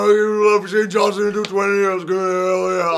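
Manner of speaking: bad gravelly Texan wrestler impression; lightly voiced tough Texan; Stone Cold impression. Shown as a demonstration. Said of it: "I can't do a good Stone Cold impression".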